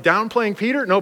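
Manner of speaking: ordinary talk, nothing marked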